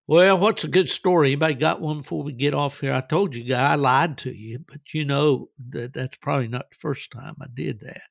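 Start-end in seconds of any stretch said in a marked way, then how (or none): none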